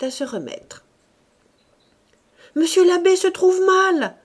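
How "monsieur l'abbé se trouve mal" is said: high, loud alarmed cry; cried out. A narrator's reading. Young ladies crying that the abbé is fainting, high and loud. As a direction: shout the alarm, frightened girls. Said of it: "s'écrièrent les demoiselles Rastoil".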